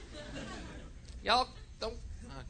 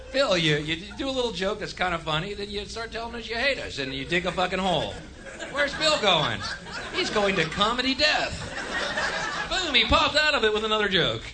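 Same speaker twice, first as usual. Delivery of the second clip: much higher, sing-song jeering voice; mocking critic voice; 9 semitones higher than usual. An imagined critic jeering at the comedian, high sing-song taunt. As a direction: taunt him like a snide heckler.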